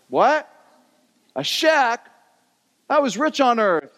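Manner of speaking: high and stunned, can't believe it; a rich man's indignant disbelief; shocked outrage. Retold or imitated. Rich man shown his shack in heaven, high shocked protest.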